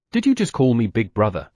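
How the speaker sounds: usual speaking voice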